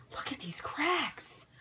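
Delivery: slow, hushed whisper of someone terrified; scared whisper. Retold or imitated. Retelling Billy in the cracked tunnel, slow frightened whisper. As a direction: breathe it out slowly like you're afraid to be heard.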